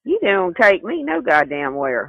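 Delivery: ordinary talk, nothing marked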